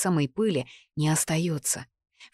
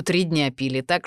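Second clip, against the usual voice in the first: usual speaking voice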